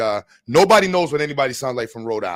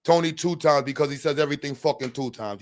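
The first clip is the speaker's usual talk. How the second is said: ordinary delivery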